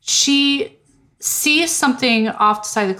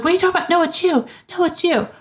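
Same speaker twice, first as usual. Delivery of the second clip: high, indignant denial; high protesting Vera; 10 semitones higher, much faster than usual. Retold or imitated. Vera denying Lombard's accusation, high and indignant. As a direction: throw the blame back at him.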